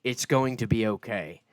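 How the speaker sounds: a booming ship captain addressing the passengers; loud captain. Acted. Captain warning the civilians about invasions, booming. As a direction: project the warning over the crowd. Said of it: "he does actually talk pretty loud".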